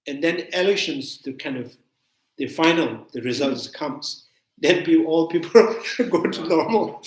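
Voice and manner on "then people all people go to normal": talking through laughter, higher and louder; amused laughing. Real, not acted. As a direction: break into a laugh mid-sentence. Laughs at post-election return to normal.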